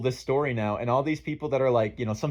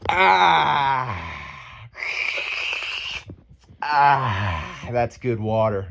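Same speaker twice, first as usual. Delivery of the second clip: obnoxious drinking sounds; much slower than usual. Retold or imitated. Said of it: "very obnoxiously".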